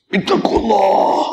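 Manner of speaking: high, slow, tearful warning, like a furious prophet; slow tearful plea to fear God; stern prophetic warning. Retold or imitated. Preacher voicing the Prophet's stern rebuke, high and slow. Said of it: "Merah je mata dia".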